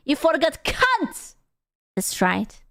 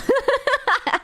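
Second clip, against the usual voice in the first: high burst of laughter; 12 semitones higher than usual. Real, not acted. Laughs at own joke.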